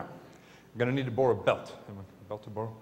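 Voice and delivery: usual speaking voice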